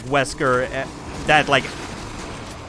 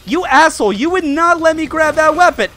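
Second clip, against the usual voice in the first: much higher, louder furious yell at the game; angry outburst; 11 semitones higher, 5 dB louder, faster than usual. Real, not acted. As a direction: yell at the boss like he cheated you.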